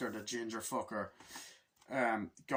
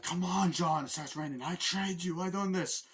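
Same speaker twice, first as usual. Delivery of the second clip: mock villain shouting; 6 semitones higher, 3 dB louder than usual. Acting villain taunting John in rain.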